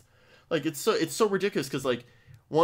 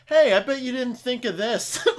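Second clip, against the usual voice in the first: high, slow, smug mock voice with a laugh; mocking know-it-all; 11 semitones higher, 5 dB louder than usual. Mocking the know-it-all visitors who think they're clever. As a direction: parody the jerk who thinks he's clever. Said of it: "the jerk-offs that go".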